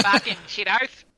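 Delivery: hammed-up broad Australian; put-on ocker Aussie. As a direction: swear like a caricature Aussie. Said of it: "do a full episode of that".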